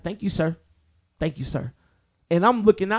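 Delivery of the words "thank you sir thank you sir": hint of a dismissive lady on a mic; imitating heckler lady. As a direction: politely shoo him off the stage. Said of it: "I hear something go behind me like".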